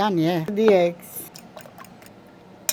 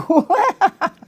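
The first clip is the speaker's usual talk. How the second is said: bright burst of laughter; high laugh; 12 semitones higher, 7 dB louder than usual. Real, not acted. Nonna laughs at her stuffing.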